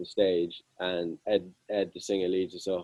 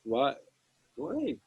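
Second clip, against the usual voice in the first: rising, high and puzzled, climbing on each question; a confused crowd member in disbelief; baffled crowd; 11 semitones higher, 3 dB quieter than usual. Retold or imitated. Crowd confused by band in cupboard.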